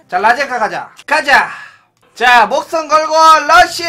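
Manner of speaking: rising to loud hyped shouts; psyching himself up for the big rush; hype shout. Real, not acted. Streamer hypes up a gambling rush.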